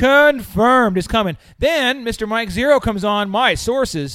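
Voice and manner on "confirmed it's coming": loud drawn-out mock confirmation; mock loud announcement. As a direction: belt it out like fake news. Mocking hype.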